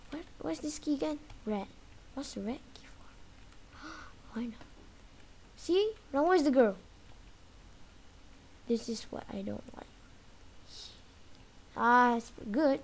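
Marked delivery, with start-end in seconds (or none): 2.2-4.7 slow hushed whisper with a gasp. Real, not acted. Gamer hiding from granny, whispering. 8.7-9.9 hushed voiceless whisper. Real, not acted. Gamer sneaking past granny, whispering.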